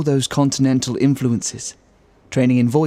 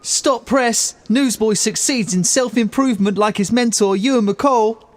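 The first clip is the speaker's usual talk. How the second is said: high, bright street newsboy calling out a headline; newsboy cry; 8 semitones higher than usual. Acted. Newsboy announcing Ewan's self-improvement, high headline cry. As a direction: shout it like a paper seller.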